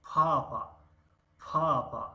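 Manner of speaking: teacher modelling پاپا; model word. Shown as a demonstration. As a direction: say پاپا crisply as a classroom model. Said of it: "هجای".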